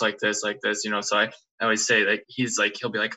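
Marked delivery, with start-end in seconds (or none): 0.0-0.8 low, soft monotone. Retold or imitated. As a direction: voice never going up or down. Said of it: "his voice never goes up or down".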